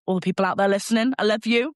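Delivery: gushing shout-out; excited shout-out. Real, not acted. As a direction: call out to your listeners with a big grin. Shout-out to listeners.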